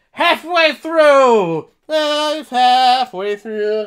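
high, loud, long-drawn cheer; triumphant shout. Acted. Viewer celebrating the halfway mark, drawn-out triumphant holler. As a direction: belt it out with stretched vowels. Celebrates reaching the film's midpoint.

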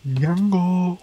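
high, drawn-out name call; playful yell. Pretend. Player yelling a film name in jest, mock yell. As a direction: call it out high without much volume. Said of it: "I just yelled Django".

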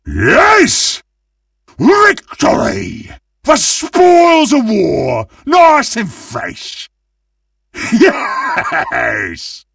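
high, slow, savouring victory cry; gleeful high-pitched gloating; triumphant. Acted. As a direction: crow over the win, stretching the words. Celebrates winning the game.

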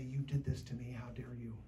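the same angry words, low and soft; a believer quietly accusing God, low and soft; quiet accusation. Acted. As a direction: say it under your breath, hurt not shouting. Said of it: "yell at him and say".

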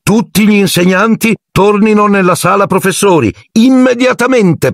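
voce acuta e tesa da annuncio scolastico; annuncio urgente. A narrator's reading. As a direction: convoca tutti con voce molto alta di tono. Annuncio urgente a tutta la scuola. (annuncio urgente, acuto)

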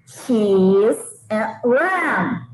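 "Cheese and ram" said very slowly, each word separate; teaching pronunciation. Shown as a demonstration. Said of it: "Presunto é ram". (pronúncia lenta de "Cheese and ram")

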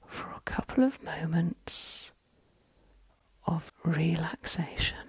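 soft, very slow meditation voice with long pauses; guided relaxation. Acted. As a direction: ease them into calm, hushed and unhurried.

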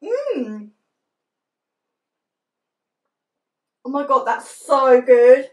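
talking behind hand; chewing while talking. Real, not acted. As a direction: mouth partly full. Seen 4: Closed lips, tasting frown, hand over mouth after eating bar.

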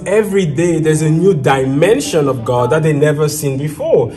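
a preacher building to a passionate peak; preaching at full force. Acted. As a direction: drive the teaching home with fervor.

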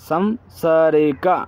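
সামসারিকা stretched out very slowly with a rise; very slow Malayalam demo. Shown as a demonstration. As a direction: drag সামসারিকা out slowly, lifting at the end.

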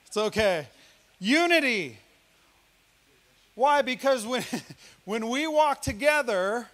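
full-force preaching on unity; preaching hard. Real, not acted. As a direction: preach it out to the congregation. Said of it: "I'm preaching hard".

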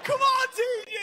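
high, loud cheering yell; mock cheer. Irish comic mimicking English fans cheering Team GB, loud. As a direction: cheer like a smug English fan.

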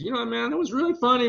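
high-pitched impression of a comedian giving feedback; Jerry. Jerry reacts to the actor's audition, imitated high voice. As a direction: lift the voice well up, imitating him. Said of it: "Jerry, um, actually said to me".

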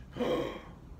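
sharp breathless gasp of shock. A narrator's reading. Reader reacting to the pirate gobbling the sandwich, gasping. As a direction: gasp like you can't believe it.

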